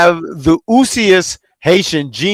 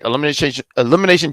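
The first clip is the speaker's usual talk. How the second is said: tripping over Elimination, fast; stumbling restart on Elimination; stuttering; much faster than usual. Real, not acted. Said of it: "I'm already stuttering".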